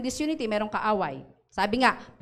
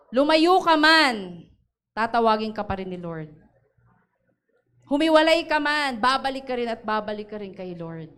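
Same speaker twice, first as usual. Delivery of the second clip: impassioned preaching; 3 dB louder than usual. Acted. Pastor assures the congregation God calls them back, impassioned. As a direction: preach it with fervour.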